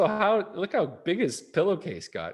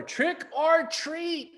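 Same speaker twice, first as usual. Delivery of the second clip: a little louder; 12 semitones higher, much slower than usual. Acted. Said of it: "He said it a little louder".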